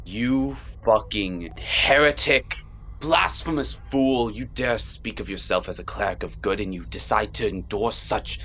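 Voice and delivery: slow, weighted denunciation of a heretic; scandalised zealot's rebuke; righteous anger. Acted. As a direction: condemn him word by word, building to an outburst. Acted denunciation of a cleric.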